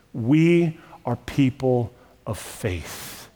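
slow, weighted "We are people of faith", stress on "We"; slow final statement, lean on "We"; slow solemn close. Acted. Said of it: "and I'm telling you".

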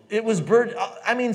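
cracking up mid-sentence; real laughter breaking in; laughing. Real, not acted. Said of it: "So I can't stop laughing".

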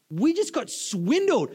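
slow, high-pitched dawning outrage; indignant realisation. Acted. Traveler realising the taxi driver conned them, indignant realisation. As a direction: draw out the shock of being had.

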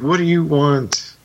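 snapping. Retold or imitated. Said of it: "Optimus initially is sort of snapped".

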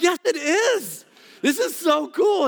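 drawn-out squeaky protest; childish insistence. Retold or imitated. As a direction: insist like a kid. Arguing with wife it's cool.

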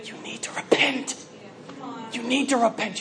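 strangled, urgent whisper; the stifled voice of humility, barely getting out; intense whisper. Acted. Humility inside you calling for repentance, in an intense whisper. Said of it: "suffocating it into an intense whisper at best".

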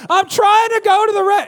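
high, loud parent's holler; harried parent's acted outburst; exasperated yell. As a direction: yell through the bathroom door. Voicing parent hiding in restroom.

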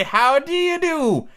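high, long-drawn storybook greeting; slow, high-pitched character voice; emphatic Tweedledum greeting. Acted. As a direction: stretch it out high and grand.

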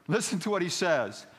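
high, loud call for attention; emphatic pulpit aside; urgent preaching. Real, not acted. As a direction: urge the congregation to listen. Preacher urges congregation to listen.

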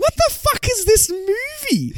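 high-pitched, drawn-out bewilderment; baffled outburst. Real, not acted. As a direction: let the exasperated disbelief burst out, slowly. Baffled outburst on being asked opinion.

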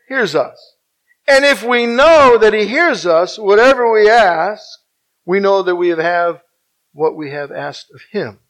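unhurried scripture reading, pausing between phrases; slow, deliberate reading. Real, not acted. Preacher reading God's promise to the congregation, slowly and deliberately. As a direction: stretch out each phrase of the verse. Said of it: "I'm gonna say this slowly now".

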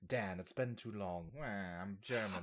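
very low, soft mock German villain; mock German accent. Villain greeting Dan in his business suit, German accent. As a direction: drop deep and quiet into a hammy German accent. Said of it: "Why'd they give him a German accent".

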